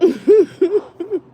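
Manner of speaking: high, loud burst of laughter. Real, not acted. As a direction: cackle out loud. Laughing at her own joke.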